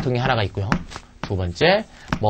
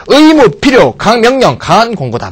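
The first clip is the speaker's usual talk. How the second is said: emphatic loud reading; 7 semitones higher, 7 dB louder than usual. Real, not acted. Teacher reads meanings aloud.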